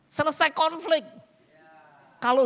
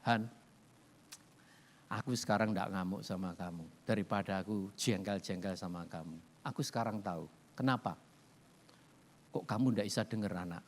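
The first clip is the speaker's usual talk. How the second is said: lower, softer calm wife's voice; wife calmly telling her husband she understands now; 11 semitones lower, 8 dB quieter than usual. Retold or imitated. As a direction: speak gently, no longer angry. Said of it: "dia bilang gini".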